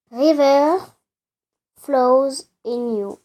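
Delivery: put-on lightly done English accent, slowing on flows in you. Reading the song title aloud, mild English accent. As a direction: say the title like a native English speaker, drawn out. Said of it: "accent anglais".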